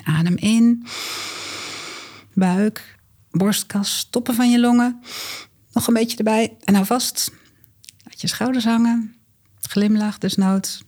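calm guided exercise voice; walk them through it gently; guided breathing. Acted. Leads the exercise together.

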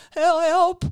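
loud, high wail for help. A narrator's reading. Innkeeper beaten by the club, wailing for help. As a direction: cry out as if being beaten. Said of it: "huilde de herbergier".

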